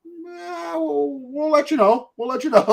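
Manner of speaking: comic put-on uncertainty; mock hesitation. Voices hesitant verdict on Mexico.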